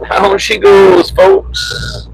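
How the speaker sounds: high, slow announcer call; excited announcer call. Acted. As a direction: call it out like a showman. Announcing the falling tree.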